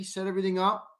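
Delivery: ordinary talk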